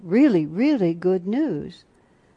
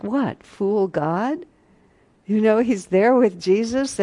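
ordinary talk, nothing marked

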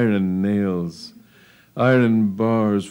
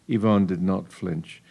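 usual speaking voice

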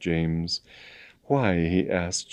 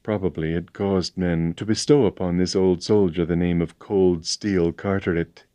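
ordinary delivery, nothing marked

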